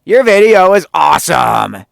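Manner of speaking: high, loud over-the-top praise; gushing Benji. Retold or imitated. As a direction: gush like a superfan. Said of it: "And he's just like".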